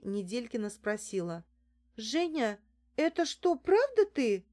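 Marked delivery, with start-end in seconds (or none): 2.0-4.5 barely recovered from shock, incredulous; stunned disbelief. A narrator's reading. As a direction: voice leaping high and louder. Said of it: "С трудом совладав с собой". (ошеломлённо, не веря)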